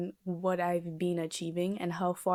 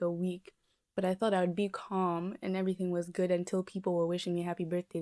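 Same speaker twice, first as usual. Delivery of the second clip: usual speaking voice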